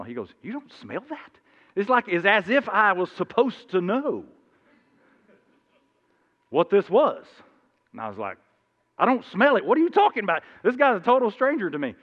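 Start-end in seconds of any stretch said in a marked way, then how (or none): 0.4-1.3 incredulous. Retold or imitated. Stranger asking incredulously in story. 9.0-10.4 puzzled protest. Retold or imitated. Narrator's retold reply to stranger.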